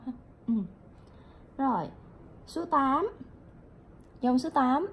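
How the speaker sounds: usual speaking voice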